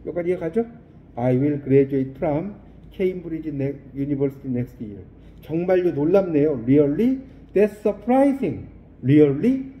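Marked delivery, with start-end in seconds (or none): 1.2-5.0 slow, deliberate English model sentence; slow model reading. Shown as a demonstration. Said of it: "model reading repeated". 6.7-7.2 mock surprise. Shown as a demonstration. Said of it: "model surprise expression". 7.5-8.7 slow, clear surprised phrase; slow model reading. Shown as a demonstration. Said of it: "model reading". 9.0-9.7 mock surprise. Shown as a demonstration. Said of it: "model surprise expression".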